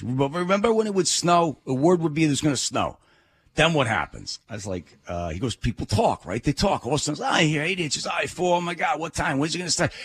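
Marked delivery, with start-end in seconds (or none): 0.0-4.4 put-on New York accent. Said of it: "in this heavy New York accent". 5.6-9.9 high, quick excited New York chatter; lightly done New York accent, excited. Retold or imitated. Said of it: "in this heavy New York accent".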